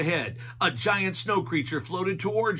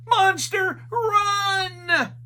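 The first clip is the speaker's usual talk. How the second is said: high, loud terrified yell; panicked scream; 6 dB louder, much slower than usual. A narrator's reading. Shaggy screaming at the monster sign, high and loud. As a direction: shriek it in cartoon panic.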